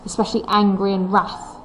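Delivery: normal talking voice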